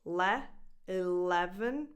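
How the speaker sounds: eLEven with le stressed. Shown as a demonstration. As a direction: lean on the le in eleven. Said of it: "stress goes on the second syllable".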